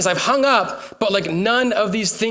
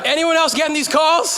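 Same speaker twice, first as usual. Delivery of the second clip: calling out; 6 semitones higher, 4 dB louder than usual. Real, not acted. Calling out to congregation.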